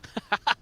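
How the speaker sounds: short burst of chuckles; delighted laugh. Real, not acted. Co-caster amused by Buzz's play, laughing. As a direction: laugh in admiration.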